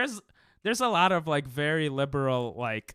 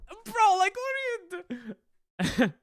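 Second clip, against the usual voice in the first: much higher, louder, squeaky mock voice of a friend; 4 dB louder, slower than usual. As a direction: squeal it like you're mimicking a baffled friend. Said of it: "Dnell being like".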